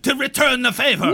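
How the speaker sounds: caged knight shouting over the interruption; indignant shout. Acted. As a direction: burst out with the last words as he is cut off. GM voices caged Sir Talavar.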